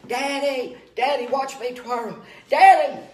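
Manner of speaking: put-on high, eager child's call; little boy calling Daddy. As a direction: call out to Daddy like an excited kid. Little boy calling his dad.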